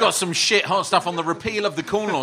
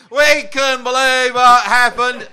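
slow, louder, higher Somerset yokel voice; Somerset accent; 6 semitones higher, 10 dB louder, much slower than usual. Retold or imitated. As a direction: drag it out in broad Somerset. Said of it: "that is an excellent Somerset accent".

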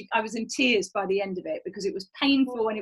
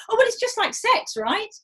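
mock innocent; 11 semitones higher than usual. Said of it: "And go".

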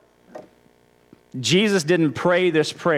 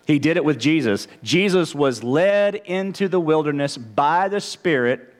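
usual speaking voice

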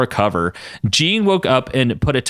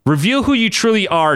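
commanding; 10 semitones higher than usual. Acted. Todd's spell command.

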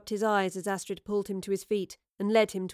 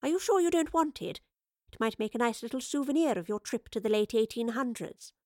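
higher-pitched, playful needling; mocking professor; 4 semitones higher than usual. A narrator's reading. Professor offers Wayland a souvenir, mocking. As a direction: offer it back with mock sweetness.

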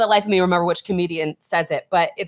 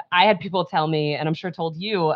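normal talking voice

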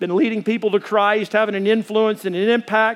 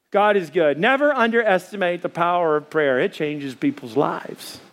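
usual speaking voice

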